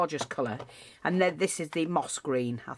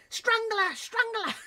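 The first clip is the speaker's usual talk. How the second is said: high, shrill mock accusing chant; high mocking crowd voice, half laughing; mock craft community; slower than usual. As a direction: ham up the outraged crafters crying strangler. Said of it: "the craft community is gonna go".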